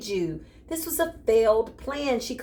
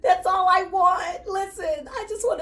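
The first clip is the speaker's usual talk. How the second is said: giggling through the words, higher and louder; laughing; 10 semitones higher, 3 dB louder than usual. Real, not acted. To the viewer, begging for sushi while laughing. Joking plea for a sushi treat.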